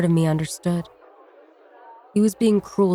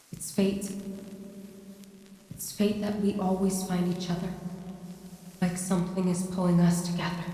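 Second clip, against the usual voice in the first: much softer, haunting memory; soft remembered voice; 9 dB quieter than usual. A narrator's reading. Narrator recalls Penn's words, hushed. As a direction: breathe it like a memory.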